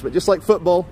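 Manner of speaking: ordinary talk, nothing marked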